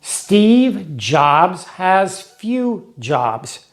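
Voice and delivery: very slow chant of the rhyme; rhyme recited slowly. Shown as a demonstration. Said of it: "make a детский стишок".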